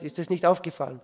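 ordinary talk, nothing marked

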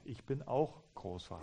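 soft, calming reassurance. Retold or imitated. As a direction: gently show fellow feeling. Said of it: "meine Stimme gesenkt". (leise und mitfühlend)

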